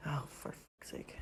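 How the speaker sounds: slow whispered curse; exasperated whisper. Real, not acted. Owner cursing her cat under her breath.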